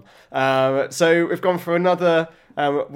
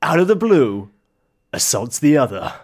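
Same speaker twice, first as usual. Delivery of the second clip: mock crime statement; 4 dB louder than usual. Parodying true crime after being hit, dramatic narration. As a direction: deliver it in portentous, separated phrases. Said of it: "can I do a statement".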